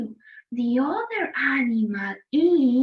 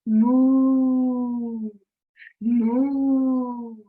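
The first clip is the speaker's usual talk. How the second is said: long, level, drawn-out moo; cow moo; 2 semitones lower, 4 dB louder than usual. Acted. Teacher hinting at the animal that gives milk, cow moo. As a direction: imitate a cow, holding the vowel. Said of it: "gives us milk and says".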